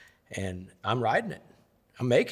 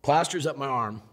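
ordinary talk

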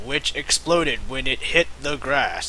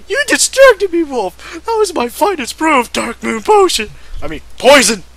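high, loud scolding of Wolf; angry scream; 11 semitones higher, 5 dB louder, faster than usual. Acted. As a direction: scold him for ruining the brew. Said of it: "She screamed at him".

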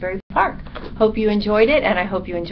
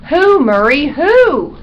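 high, loud pleading demand; impatient dog's loud cry; 8 semitones higher, 9 dB louder, slower than usual. A narrator's reading. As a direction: cry out for the name, exasperated. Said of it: "cried the dog".

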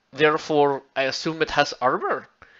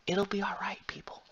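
soft unvoiced whisper of reassurance; 5 semitones higher, 12 dB quieter than usual. Vlogger whispering reassurance to his viewers, soft mock whisper. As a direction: hush them gently, it'll be all right.

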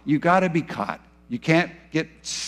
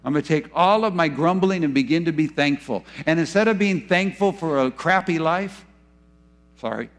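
normal talking voice

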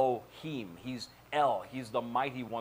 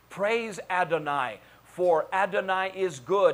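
slow, raised, exultant proclamation; forceful scripture reading; 5 semitones higher, 6 dB louder, slower than usual. Acted. Preacher reading a psalm of praise to congregation, forceful preaching.

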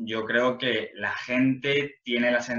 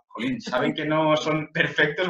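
envious sigh; 6 semitones higher than usual. Retold or imitated. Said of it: "Decía".

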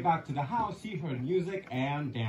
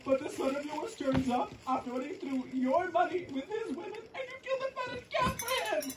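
much higher, aggrieved son's voice, squeaking at the end; whiny retort; 11 semitones higher than usual. Acted. Older son protesting to his father, high whiny retort. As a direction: complain like a wronged big brother. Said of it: "he retorted".

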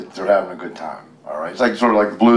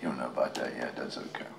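whisper; 9 semitones lower, 17 dB quieter, faster than usual. Real, not acted. Reassuring the child quietly.

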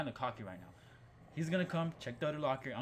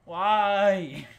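loud, high wail of "why"; startled yell; 8 semitones higher, 16 dB louder than usual. Real, not acted. Player grabbed in a horror game, loud protesting wail.

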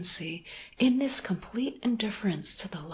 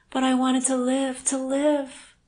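louder, rising cry of longing; desperate outburst; 7 semitones higher, 7 dB louder than usual. Acted. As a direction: cry out how badly she wanted to live. Desperate confession, repeated plea.

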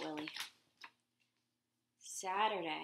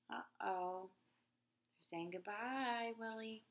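usual speaking voice

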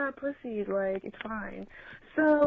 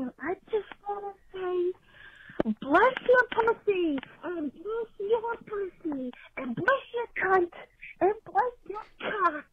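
put-on high, quavering old-lady voice, shouting blessings; hammed-up eighty-seven-year-old blessing everyone at the table; mock frail grandma yelling; 9 semitones higher than usual. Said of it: "just yelling".

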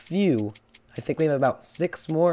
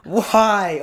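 frustrated yell; 6 semitones higher, 7 dB louder, slower than usual. Real, not acted. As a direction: voice cracking on a loud, high cry of frustration. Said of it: "My voice is cracked".

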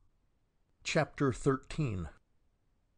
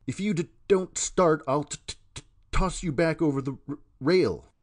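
much higher and louder, catching on t and r; furious stammered threat; raised stuttering threat; 6 semitones higher, 7 dB louder than usual. A narrator's reading. Billy threatens to toss the stranger overboard, stuttering loud. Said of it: "by Billy's raised voice".

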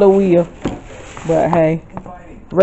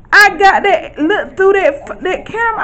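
much higher, giggling with delight; high, giddy telling; excited laughing; 10 semitones higher than usual. Real, not acted. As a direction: laugh through the happy memory. Recalls finding the camera.

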